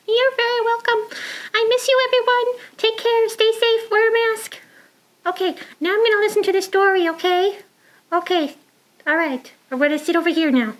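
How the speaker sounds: high fast puppet chatter; Trudy puppet voice. Acted. Puppet Trudy talks to kids.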